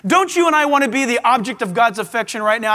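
normal talking voice

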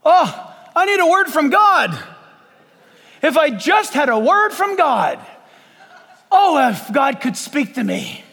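high, loud, hammed-up begging; over-the-top mock desperation; exaggerated pleading. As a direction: beg melodramatically like a needy believer. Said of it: "I'm exaggerating right now".

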